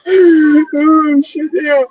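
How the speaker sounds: helpless high-pitched laughter, words squeezed out; laughing hard. Real, not acted. Cracking up with friends over farm animal jokes, laughing hard. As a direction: laugh your way through the words.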